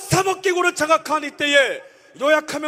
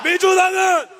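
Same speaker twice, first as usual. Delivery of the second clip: roaring rallying speech; 3 semitones higher than usual. Acted. Rally chant lead.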